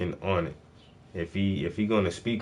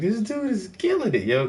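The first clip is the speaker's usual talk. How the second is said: much higher pitched, excited retelling; recalled excitement, pitched way up; amazed hype reaction; 13 semitones higher than usual. Retold or imitated. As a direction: squeal it like an impressed fan, reliving the moment. Retelling amazed first reaction to rapper.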